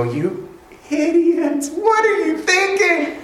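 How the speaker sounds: high-pitched mock scolding; hammed-up incredulous reproach; exasperated outburst. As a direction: scold them in squeaky disbelief. Imagined Jesus fed up with disciples.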